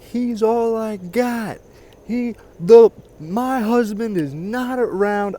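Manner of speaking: high, slow, whining imitation of the mother; mock her pleading in a high, dragging voice; mock whiny mother. Mocking the mother on the news as she pleads, high whining voice. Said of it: "she starts talking about, like".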